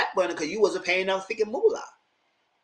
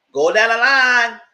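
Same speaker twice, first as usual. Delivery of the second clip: loud, slow barked order; slow mocking shout down the line; 7 semitones higher, 10 dB louder, slower than usual. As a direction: bark it out like a doorman. Mimicking a shout at customers.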